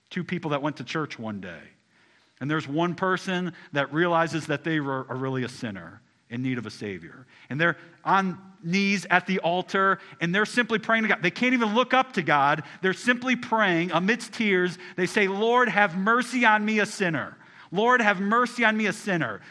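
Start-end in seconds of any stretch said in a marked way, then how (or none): 2.4-15.3 higher, louder impassioned preaching; preaching at full force. Acted. Pastor rebuking the congregation, rising to a loud preach. 15.3-19.4 louder, higher cry for mercy; loud fervent plea. Acted. Praying sinner at the altar, loud and fervent. Sinner's prayer voiced in parable.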